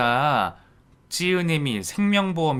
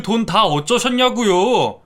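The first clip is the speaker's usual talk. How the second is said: angrier demand; 7 semitones higher, 6 dB louder than usual. Acted. Son repeats demand louder.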